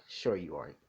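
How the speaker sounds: low-pitched, understated sarcasm; mildly sarcastic. Acted. Mother doubts her son isn't lying, mildly sarcastic. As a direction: play along, plainly not believing it. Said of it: "being, like, sarcastic or something".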